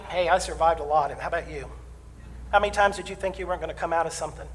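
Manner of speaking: ordinary delivery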